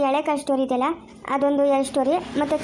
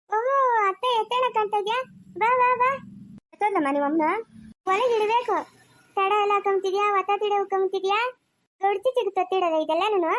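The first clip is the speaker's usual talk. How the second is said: high-pitched, needling character voice; pry in a high put-on voice; nosy village woman; 7 semitones higher than usual. Village woman prying about new finery, high-pitched needling.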